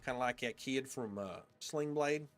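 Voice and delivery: imitating a friend's thick Southern accent. Shown as a demonstration. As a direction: talk like a hillbilly kid, loud Southern twang. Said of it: "talking like he had an accent like this".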